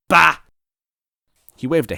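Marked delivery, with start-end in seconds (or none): 0.1-0.4 loud scoffing bah; dismissive bah. Acted. Villain scoffing at the captive, loud bah. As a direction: scoff it off loudly.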